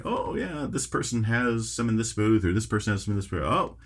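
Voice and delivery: put-on high, quick elderly clerk voice; old person voice. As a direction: raise the pitch like an old shopkeeper. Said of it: "my old person voice".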